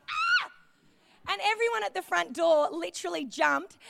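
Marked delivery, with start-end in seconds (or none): 0.1-0.5 high startled yelp; startled "ah!" scream; short re-enacted scream. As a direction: let out a brief, very high shriek. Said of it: "literally screamed, like".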